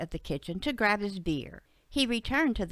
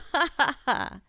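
acted derisive ha-ha laugh, pitched up; mocking snigger; 5 semitones higher, 5 dB louder than usual. Bud makes fun of Betsy's story, sniggering. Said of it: "Bud sniggered".

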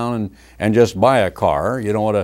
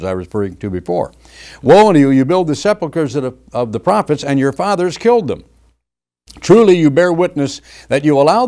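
raised, ringing scripture reading; firm pulpit reading of judgement; preaching scripture; 4 semitones higher than usual. Acted. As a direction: declaim the woes like a prophet. Long scripture passage read.

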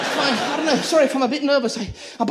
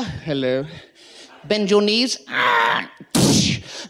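deep doctor voice; 10 semitones lower, much slower than usual. Acted. Doctor voice acted.

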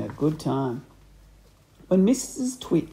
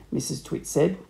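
usual speaking voice

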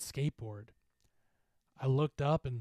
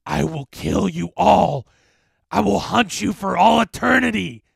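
loud, roaring demon voice; menacing monster bellow; threatening specter; 6 semitones higher, 15 dB louder than usual. A narrator's reading. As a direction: roar a deadly curse.

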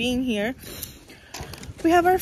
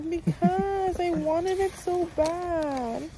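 high, slow, tearful whine; on verge of tears; 7 semitones higher, much slower than usual. Real, not acted. Girlfriend explaining to boyfriend why she's moved, tearful whine. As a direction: draw it out high, overcome with emotion. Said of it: "I'm literally gonna cry".

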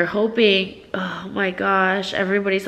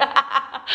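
giggle; 5 semitones higher, 4 dB quieter than usual. Real, not acted. Thrilled by the doll.

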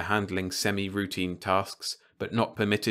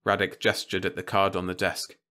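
plain reading voice

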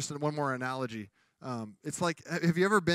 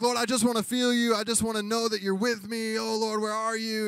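pleading prayer; 6 semitones higher, 4 dB louder than usual. Retold or imitated. Preacher acts out pleading prayer.

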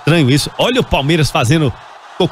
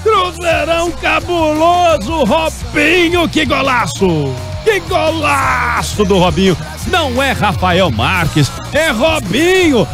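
goal commentary; 8 semitones higher, slower than usual. Real, not acted. Drawn-out goal call.

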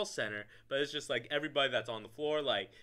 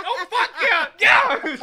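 much higher, much louder triumphant yelling; drunken scream; 16 dB louder, slower than usual. Retold or imitated. Drunk raffle winner screaming for joy at the party, full-throated yell. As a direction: scream it at the top of your lungs. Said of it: "screaming at the top of my lungs".

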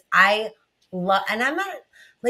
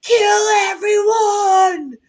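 much higher, louder, slow yell; mock vengeful shout; 12 semitones higher, 8 dB louder, much slower than usual. After the movie, a drawn-out vengeful yell. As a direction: bellow it out, drawn out. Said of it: "I was like".

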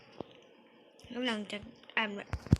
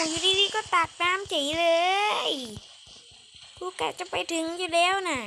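higher, louder protest; indignant outcry; 6 semitones higher, 7 dB louder than usual. Real, not acted. Player protesting that her friend suddenly scooped water, loud. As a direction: complain loudly that he spoiled it.

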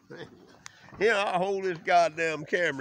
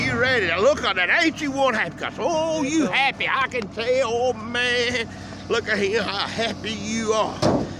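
loud teasing cheer; 9 dB louder than usual. Real, not acted. Teasing buyer about being happy.

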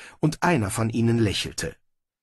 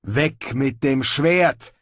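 slow, drawn-out order; called command; 3 semitones higher, 3 dB louder, much slower than usual. A narrator's reading. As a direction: order the weapon down, word by word. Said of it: "rief John O'Reilly". (befehlend gerufen)

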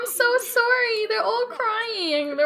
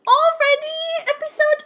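high, loud panicked cry; alarmed yell; 9 semitones higher, 6 dB louder than usual. Real, not acted. Player panicking as a character is about to die, high loud yell. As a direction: blurt it out in sudden alarm.

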